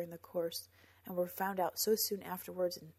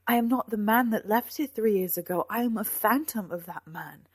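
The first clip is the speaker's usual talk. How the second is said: loud, emphatic protest; knight's raised, impassioned denial; anguished protest; 3 semitones higher, 10 dB louder than usual. A narrator's reading. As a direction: declare it with force. Knight protests in a lovers' quarrel.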